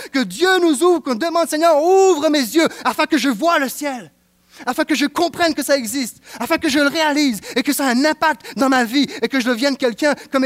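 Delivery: loud, high fervent preaching; impassioned sermon climax; preaching at full force. Real, not acted. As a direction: pour it out to the congregation.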